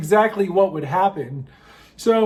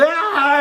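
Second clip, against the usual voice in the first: put-on sharp drunken shout, high; drunk yell; 8 semitones higher than usual. Drunk friend at the bar, sharp yell. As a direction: let out a wild drunk yell. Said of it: "she's like".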